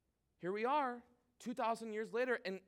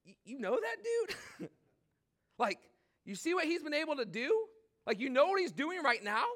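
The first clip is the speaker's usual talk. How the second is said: higher, incredulous voice for Ananias; mock-disbelieving Ananias; Ananias doubting; 7 semitones higher than usual. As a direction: play the doubter who can't believe it. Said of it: "what does Ananias say".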